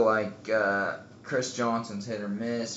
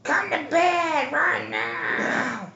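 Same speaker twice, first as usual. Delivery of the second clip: high, slow shrill-wife call; nagging wife; 6 dB louder, much slower than usual. Acted. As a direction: shriek him to bed like a nagging wife. Plays nagging wife Shannon.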